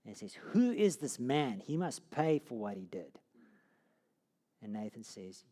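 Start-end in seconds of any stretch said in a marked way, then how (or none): 0.3-3.2 louder, slow, indignant demand; furious. Retold or imitated. David hearing of the stolen sheep, indignant loud demand. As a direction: demand justice with rising outrage. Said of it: "David naturally becomes furious".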